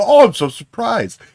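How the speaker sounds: high, loud, hammed-up gasp of surprise; mock surprise. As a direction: overplay being amazed, high and loud. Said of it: "and go".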